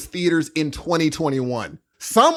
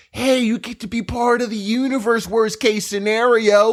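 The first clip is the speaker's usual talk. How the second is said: lightly voiced dreamy spiritual tone, a little higher; flowy Bali voice; 7 semitones higher than usual. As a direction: mimic the post-Bali wife's floaty talk. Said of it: "Why are we coming back talking like this?".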